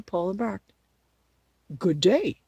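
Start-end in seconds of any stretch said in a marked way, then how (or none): 1.8-2.4 mildly husky scarecrow greeting; a loud, rather husky hello. A narrator's reading. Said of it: "in a rather husky voice".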